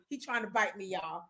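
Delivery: dropped low and soft to the viewers; half-laughing aside about the puppy; amused aside. Real, not acted.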